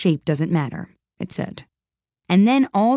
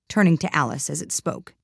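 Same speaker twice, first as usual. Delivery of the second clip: ordinary delivery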